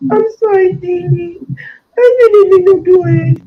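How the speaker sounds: put-on high, loud pleading child voice; voicing Dee Dee's apology. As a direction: plead like a frightened girl.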